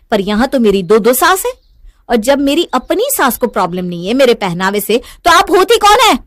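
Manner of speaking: loud accusation, mostly high-pitched; angry. Acted. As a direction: challenge her with rising fury and volume. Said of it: "गुस्से में नैना". (ग़ुस्से में ज़ोर से ताना)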